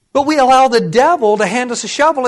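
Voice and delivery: ordinary talk, nothing marked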